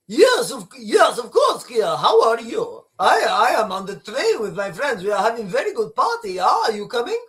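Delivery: put-on botched French-accented phone chat; Emmanuel Macron. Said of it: "I can't do the French accent".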